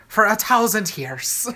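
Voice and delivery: imitating a drawn-out Irish commercial voice; slow, mock Irish accent. Listener mimics the lady in a cheese commercial, Irish accent. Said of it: "where the lady's like".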